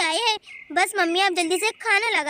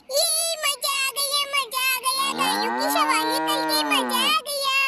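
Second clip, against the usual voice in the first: put-on a small girl thrilled after a ride; Munni's little girl voice; 3 semitones higher than usual. Munni comes back delighted.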